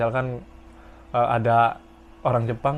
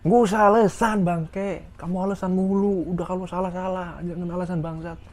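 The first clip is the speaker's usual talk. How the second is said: angry senior; 5 semitones higher than usual. Retold or imitated. Senior berating a junior's excuses, high contemptuous scold.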